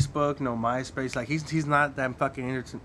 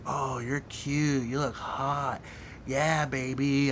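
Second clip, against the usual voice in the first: mock flirty woman; much slower than usual. Retelling woman's flirty text.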